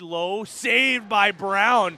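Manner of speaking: loud, drawn-out save call; excited call. Real, not acted. Play-by-play caller roaring at the save. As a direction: belt out the big save.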